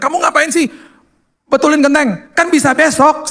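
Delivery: high-pitched, incredulous telling-off; exasperated scolding. Retold or imitated. Alarmed husband scolding his wife for fixing roof tiles, exasperated.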